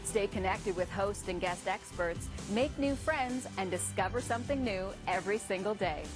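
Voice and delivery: upbeat ad read over music; promo announcer. Acted.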